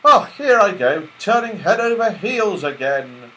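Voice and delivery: high, lightly voiced giddy Joe; cheerful helpless tumble; Joe tumbling. Joe somersaulting off the train, high and giddy.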